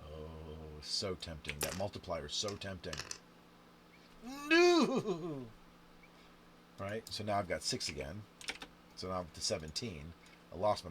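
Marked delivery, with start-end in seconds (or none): 4.5-5.5 high, loud cry of defeat; dismayed no. Real, not acted. Player losing his multipliers, crying out. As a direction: yelp as the roll fails. Bad roll loses multipliers.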